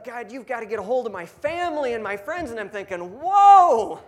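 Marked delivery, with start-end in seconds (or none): 0.0-2.5 higher, louder and urgent, pleading to God; eager prayer. Retold or imitated. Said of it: "Now he's like". 3.2-4.0 a loud, much higher burst of amazement; shocked whoa. Retold or imitated. Narrator amazed at new convert.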